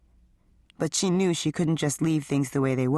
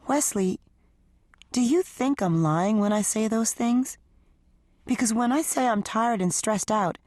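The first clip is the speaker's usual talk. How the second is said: a hint of a higher, hurt wife defending herself; lightly voiced wife; 5 semitones higher than usual. Wife answering husband's accusation, higher hurt voice. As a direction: plead your case, a little raised.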